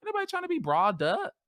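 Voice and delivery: high, sing-song complaining; whiny refusal. Retold or imitated. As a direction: whine like a tired teenager. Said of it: "I'd just be like".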